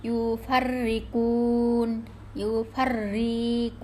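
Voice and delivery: slow, drawn-out ইউফাররিকুন, first R heavy, second light; thick-then-thin R. Shown as a demonstration. Teacher models the word for students, slow recited ইউফাররিকুন. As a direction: stretch ইউফাররিকুন, rolling the doubled R. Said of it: "মোটা".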